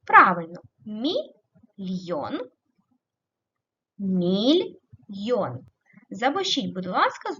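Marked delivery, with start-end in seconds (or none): 0.8-2.4 "мільйон" slowly broken as мі, pause, льйон; split for hyphenation. Shown as a demonstration. Teacher showing one line-break division, slow split syllables. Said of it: "по-різному для переносу". 4.0-5.6 "мільйон" slowly broken as міль, pause, йон; split for hyphenation. Shown as a demonstration. Teacher showing the other line-break division, slow split syllables. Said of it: "по-різному для переносу".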